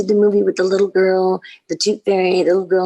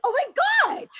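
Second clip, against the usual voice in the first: very high-pitched retold squeal; re-enacted starstruck gasp of delight; thrilled disbelief; slower than usual. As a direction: squeal it like an overwhelmed fan. Her thrilled reaction, retold.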